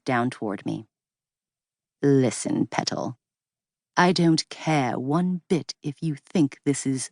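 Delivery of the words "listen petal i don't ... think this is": lightly voiced English accent, condescending and cool; a hint of a patronizing Englishman. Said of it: "that same melodic English accent".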